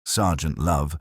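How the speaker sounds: ordinary delivery, nothing marked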